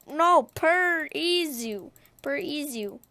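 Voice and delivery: "Per Izu" pulled apart and drawn out; drawn-out name. Shown as a demonstration. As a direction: stretch Per Izu into separate, slow syllables. Draws out the name playfully.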